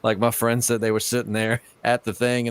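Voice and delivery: ordinary delivery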